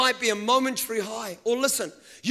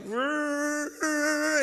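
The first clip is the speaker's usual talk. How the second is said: a long, higher drawn-out motor trill; long engine brrr; 5 semitones higher, 4 dB quieter than usual. Retold or imitated. Preacher imitating a go-kart speeding up, long trill. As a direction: stretch out the engine noise. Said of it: "it's like".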